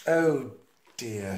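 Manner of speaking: ordinary delivery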